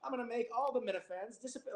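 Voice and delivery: put-on high old-timey carnival barker; 1930s news broadcast voice. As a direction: hawk it like a 1930s street seller. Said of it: "like a nineteen thirties news broadcast".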